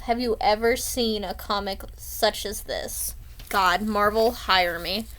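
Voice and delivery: ordinary talk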